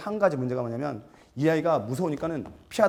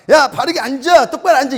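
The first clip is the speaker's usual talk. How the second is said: instructor's yell; 10 semitones higher, 12 dB louder than usual. Acted. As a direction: shout 바른 자세 해 at the students. Acting yelling instructor. (높고 크게 외치는 사범)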